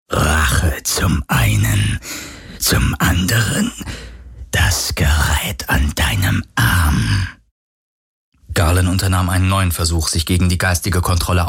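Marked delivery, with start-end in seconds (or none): 0.1-7.3 lower, slow spectral whisper; ghostly voice. Acted. The phantom names what it wants, low and whispering. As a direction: savour the threat like a hungry phantom. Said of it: "fragte die Geisterstimme".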